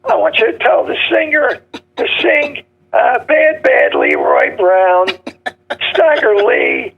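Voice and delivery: throaty mob voice, song titles spelled out slowly; mobster with a bad throat. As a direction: imitate the no-neck mobster, drawing out each song title. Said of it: "They always talk like that".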